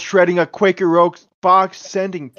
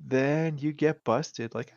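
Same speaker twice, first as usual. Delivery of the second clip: usual speaking voice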